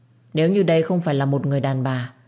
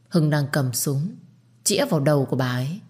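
ordinary delivery

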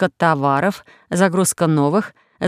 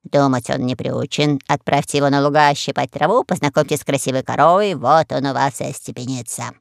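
parrot voice; 5 semitones lower than usual. Acted. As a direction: advise like a know-all pirate parrot. Parrot advises the king.

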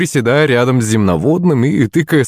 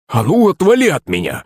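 higher-pitched indignant order; angry frog cry; 6 semitones higher than usual. A narrator's reading. Frog flicked on the nose by Аннушка, indignant cry. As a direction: cry out crossly in a raised pitch. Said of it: "закричала лягушка голосом слуги".

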